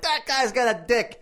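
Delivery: high, drawn-out mock admiration; mock-impressed crowd. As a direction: marvel like an impressed bar patron. Acts out excited bar crowd.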